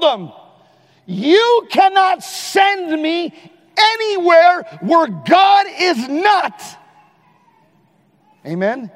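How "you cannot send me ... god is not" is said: defiant shout. Retold or imitated. Sermon climax, defiant quote.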